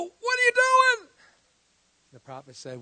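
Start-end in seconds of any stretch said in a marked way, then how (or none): 0.0-1.1 very high, loud, slow shocked cry; breathless, high outcry; gasping cry. Retold or imitated. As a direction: gasp it out after near drowning. Coming up from drowning.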